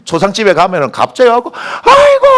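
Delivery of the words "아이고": very high, drawn-out mourning cry; mock funeral wail. Imitating a mourner arriving at a funeral, exaggerated wailing. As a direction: burst into a hammed-up wail. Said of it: "하고 한참 울 줄 알았는데".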